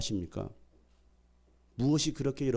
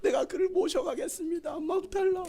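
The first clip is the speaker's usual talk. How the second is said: very high-pitched pleading; insistent demanding. Acted. Mary begging to take the body away, high insistent plea. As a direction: press the demand in a strained high register. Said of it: "예수님의 시신을 막 달라고 하는".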